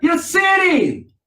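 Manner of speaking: drawn-out loud high cry; slow, loud preacher's rebuke; slow preaching shout. Real, not acted. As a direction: stretch each word and shout it.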